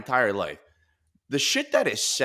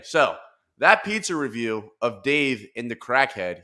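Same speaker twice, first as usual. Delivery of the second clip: usual speaking voice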